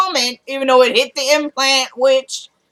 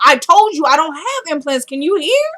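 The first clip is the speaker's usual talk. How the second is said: raised, exasperated retort rising at the end; indignant; 5 semitones higher, 3 dB louder than usual. Retold or imitated. Patient correcting the doctor about implants, indignant. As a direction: cut in higher with an indignant challenge.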